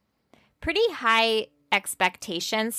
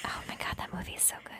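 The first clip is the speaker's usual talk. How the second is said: whisper; 15 dB quieter, much faster than usual. Real, not acted. Gushing about a favourite movie.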